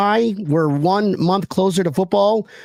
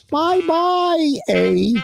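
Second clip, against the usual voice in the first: lightly put-on Canadian bye; a playful Canadian farewell; Canadian accent; 10 semitones higher, much slower than usual. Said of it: "I was trying to do my best Canadian accent".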